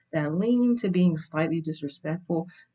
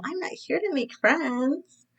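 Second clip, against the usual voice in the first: high, haughty reality TV catchphrase; reality show diva; 11 semitones higher than usual. Retold or imitated. Imitating girls on America's Next Top Model, haughty diva voice. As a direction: sneer it like a Top Model contestant. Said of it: "America's Next Top Model".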